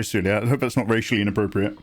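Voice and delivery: ordinary talk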